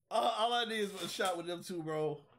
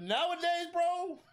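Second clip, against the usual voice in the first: excited; 13 semitones higher, 5 dB louder, slower than usual. Real, not acted. Ranting about Jacksonville Hooters.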